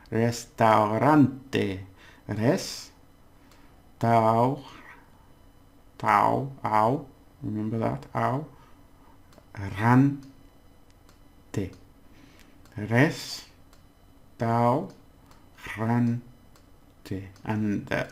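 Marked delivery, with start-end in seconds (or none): 0.1-1.8 slow careful pronunciation. Shown as a demonstration. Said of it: "this is a difficult one". 2.3-16.2 "Restaurante" broken into res, tau, ran, te with long gaps; syllable by syllable. Shown as a demonstration. Teacher breaks down a difficult word for learners, syllable by syllable. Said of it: "this is why you divide them".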